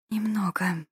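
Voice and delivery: lightly acted female moan; soft moan. A narrator's reading. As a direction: moan the answer faintly. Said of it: "простонала женщина". (тихий лёгкий стон)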